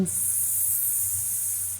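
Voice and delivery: slow, stretched slithering s-; slithering hiss. Shown as a demonstration. Teacher showing the snake sound, slow and hissing. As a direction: draw the s out like a snake. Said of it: "snake sound".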